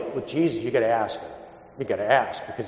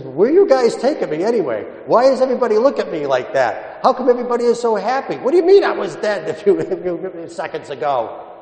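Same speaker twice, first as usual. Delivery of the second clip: higher, louder, bewildered questions from the just-raised man; baffled young man; 8 semitones higher, 5 dB louder than usual. Retold or imitated. Dead young man, just raised, asks the crowd, higher and louder in confusion. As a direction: sound confused waking up among happy mourners. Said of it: "He was probably saying".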